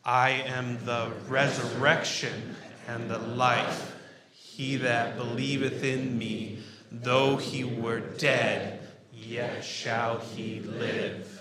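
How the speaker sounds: very slow, loud, stretching "resurrection", "life", "dead" and "live"; weighty recitation leaning on "resurrection", "life", "dead", "live"; emphatic group recitation. Shown as a demonstration. Preacher leading congregation in recitation, slow emphasis on "resurrection", "life", "dead", "live". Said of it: "give a little bit more emphasis".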